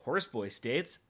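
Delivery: usual speaking voice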